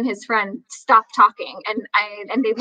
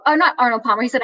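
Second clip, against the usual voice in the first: ordinary delivery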